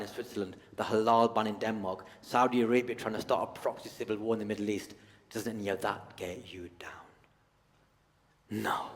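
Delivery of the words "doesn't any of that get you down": whispered concern. Retold or imitated.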